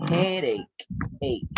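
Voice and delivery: "headache", then its ending "ache" alone; pronunciation demo. Shown as a demonstration. Teacher drilling a word ending for students, pronunciation demo. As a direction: model "headache" and isolate "ache" after a pause. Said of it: "sounds like".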